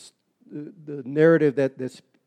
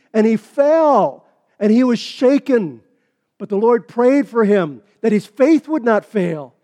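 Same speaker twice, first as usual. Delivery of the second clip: louder, higher, driving sermon voice; full-force preaching; 7 semitones higher, 8 dB louder than usual. Acted. Pastor preaching to his church, loud and higher. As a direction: preach it hard and high to the congregation.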